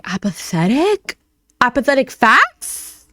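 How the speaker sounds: slow, questioning mock disbelief; incredulous echo. Girlfriend echoes being called apathetic, incredulous. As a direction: draw the word out into a rising question. Acts out Emily questioning the accusation.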